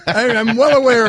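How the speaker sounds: high-pitched, through laughter; laughing protest. Real, not acted. Host answers the teasing about his phrasing, laughing protest. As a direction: protest while cracking up.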